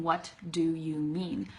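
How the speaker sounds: slow, separate "What", with its T; careful, every word said in full. Shown as a demonstration. Teacher showing what she is not saying, word by word. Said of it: "I'm not saying".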